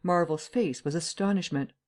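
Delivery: plain reading voice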